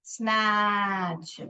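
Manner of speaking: स्नैच drawn out slowly with a short vowel; slow careful demo. Shown as a demonstration. Teacher modelling स्नैच slowly for the class. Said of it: "short वॉल है".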